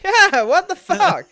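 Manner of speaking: loud, high, drawn-out outrage; outraged what the fuck. Real, not acted. Ranting host to cohosts, loud high outrage. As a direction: explode in disbelief.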